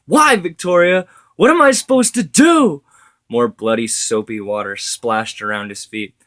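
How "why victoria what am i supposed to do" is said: much higher, much louder cry of despair; anguished yell. A narrator's reading. Widower screaming at his dead wife in despair. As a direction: hurl the question at her, breaking.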